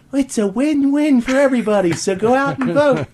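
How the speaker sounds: high, sing-song sales pitch; mock cheery spokeswoman. Host parodying the ad spokeswoman, high and sugary. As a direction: sell it like a bubbly ad lady, pitched high. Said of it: "and she'll go".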